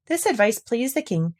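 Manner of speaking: usual speaking voice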